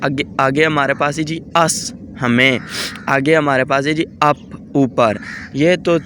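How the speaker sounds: ordinary talk, nothing marked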